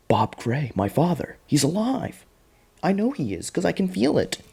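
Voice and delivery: high-pitched mock wonder, climbing as it goes; hammed-up hopeful daughter. Daughter believing her father lives, high mock-earnest wonder.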